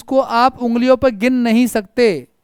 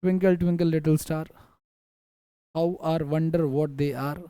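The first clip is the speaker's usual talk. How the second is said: soft, low, slow recital of a nursery rhyme; childhood rhyme recital; 7 semitones lower, 9 dB quieter, much slower than usual. Retold or imitated. Teacher quoting a student's remembered childhood poem, soft slow rhyme recital. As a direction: recite the rhyme slowly under your voice. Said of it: "बचपन की poem उसको याद थी".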